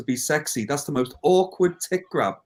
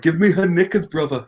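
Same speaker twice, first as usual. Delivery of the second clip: lightly voiced Arnold impression; hint of an Austrian muscleman voice; 6 semitones higher, 6 dB louder than usual. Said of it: "He's like".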